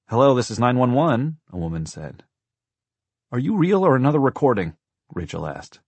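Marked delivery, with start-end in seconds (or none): 0.1-1.4 higher, louder phone greeting; pitched-up operator's voice; woman operator. A narrator's reading. Woman operator answers emergency call. 3.3-4.8 put-on high, loud child's question; raised girl's voice; urgent little girl. A narrator's reading.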